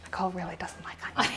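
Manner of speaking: fast, secret whisper; whispered aside. Real, not acted. Teasing aside about Nicole to viewers, whispered.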